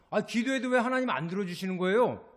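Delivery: loud, high protest; arguing complaint. Acted. Ungrateful believer challenges God over prayer, loud arguing complaint. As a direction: take God to task, loudly. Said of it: "이렇게 따지기까지 하는".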